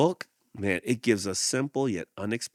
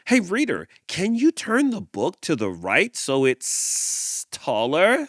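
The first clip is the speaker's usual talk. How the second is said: eager snake; 9 semitones higher, 5 dB louder than usual. Acted. Snake asks reader.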